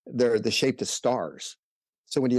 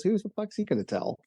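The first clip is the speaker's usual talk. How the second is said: incredulous retort; 10 semitones higher than usual. Retold or imitated. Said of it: "He goes".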